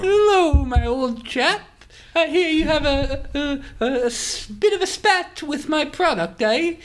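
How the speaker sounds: put-on posh English accent. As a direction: play the Monopoly tycoon in a plummy English accent. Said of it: "Are you English or Australian?".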